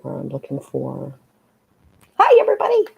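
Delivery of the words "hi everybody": high, loud sing-song hello; bright greeting. Real, not acted. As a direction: call out a cheery hi. Greeting stream chat.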